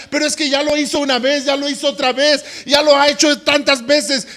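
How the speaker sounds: high, loud, fast exasperated objection; the preacher voicing a church member's objection, exasperated; exasperated protest. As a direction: throw up your hands at it.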